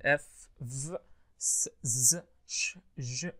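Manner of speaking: isolated pairs "س، ز" and "ش، ج", each hiss then buzz; unvoiced against voiced. Shown as a demonstration. Teacher reviewing sound pairs, bare consonants held briefly. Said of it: "الفويس دول unvoiced".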